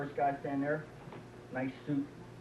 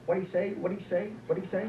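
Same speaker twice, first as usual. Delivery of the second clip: hard-of-hearing wife; 4 semitones higher than usual. Acted. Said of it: "And the wife's going".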